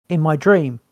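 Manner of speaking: ordinary delivery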